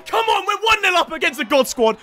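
high, excited goal celebration; elated high-pitched cheer; triumphant shouting. Real, not acted. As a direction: shout the score with glee. Celebrating lead against strong team.

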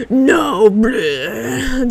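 mock roar of refusal into spewing noise; imitated dragon attack. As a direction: mimic a monster breathing fire. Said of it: "That homie was just like".

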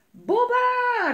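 loud, high, stretched-out call; urgent long-distance yell; alarmed shout. A narrator's reading. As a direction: holler the name across a meadow. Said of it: "riep Melle".

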